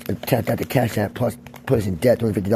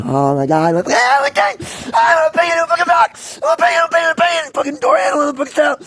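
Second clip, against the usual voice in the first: frantic high-pitched screaming persona; locked-up alter personality; 9 dB louder than usual. Acted. Personality he kept up while locked up, wild high shouting. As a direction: shriek it over and over at full pitch. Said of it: "maintain one guy the whole time".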